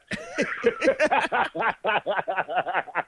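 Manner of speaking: real rising laughing fit; high, rolling real laughter; long laugh. Real, not acted. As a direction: crack up at the text. Laughing at Deke's text.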